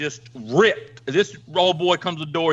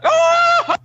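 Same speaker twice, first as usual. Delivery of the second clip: loud high mock wail of grief; wife hearing of the death, loud high wail; hammed-up wail; 7 dB louder than usual. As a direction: shriek the news like a soap widow.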